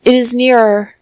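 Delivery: men in doorways shout the news to the street. A narrator's reading. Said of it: "shouting the news to the passerby".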